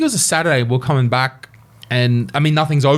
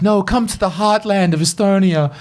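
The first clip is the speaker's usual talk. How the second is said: higher, lightly voiced eager girlfriend; a hint of a proud local girl; 8 semitones higher than usual. Imagined girlfriend inviting him to Kuressaare, lightly voiced and eager. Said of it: "she's like".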